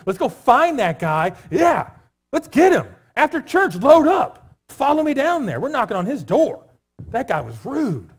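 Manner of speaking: loud, high call to arms; mock rallying cry. Preacher jokingly rallying church after a critic, loud mock battle cry. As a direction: whip up the crowd like a mob leader.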